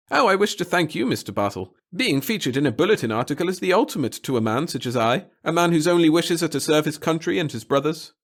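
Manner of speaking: nervous official. Acted. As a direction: fuss and caution him.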